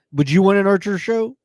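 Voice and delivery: ordinary talk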